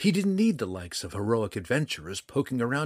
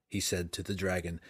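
usual speaking voice

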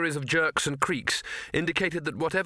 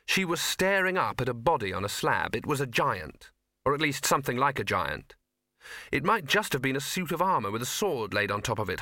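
ordinary delivery

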